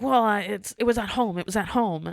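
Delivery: loud imitation of an upset customer. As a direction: retell her protest with the volume up. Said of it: "lowering your voice".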